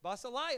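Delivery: Basileia as bah-seh-LIE, high and loud; triumphant choice. Shown as a demonstration. Pastor answering his own question with the fun Basileia, loud. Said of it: "Which one would you choose?".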